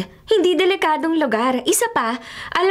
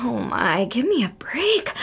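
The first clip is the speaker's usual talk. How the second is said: slow, breathy whisper to herself; whispered aside; 4 semitones lower, 3 dB quieter, slower than usual. Acted. Daughter muttering about her father, whispered. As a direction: whisper "give me a break" under your breath, slowly.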